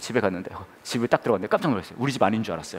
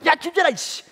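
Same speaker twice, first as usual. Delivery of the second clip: very high, loud ranting yell; even angrier dad; 5 dB louder than usual. Retold or imitated. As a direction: rant at the child in outrage. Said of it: "얘한테 더 화가 나가지고". (더 화가 난 아빠가 고래고래 소리치듯)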